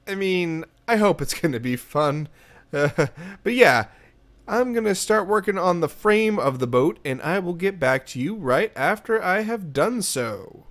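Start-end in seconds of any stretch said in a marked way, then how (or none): none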